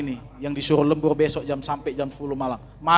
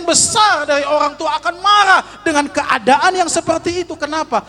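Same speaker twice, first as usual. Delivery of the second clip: much higher and louder, fervent pulpit cry; preaching at full force; 13 semitones higher, 6 dB louder than usual. Acted. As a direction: exclaim it to the whole congregation. Preacher exclaiming to congregation. (khotbah berapi-api, suara tinggi dan keras)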